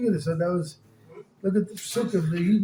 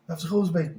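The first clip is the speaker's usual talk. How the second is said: ordinary talk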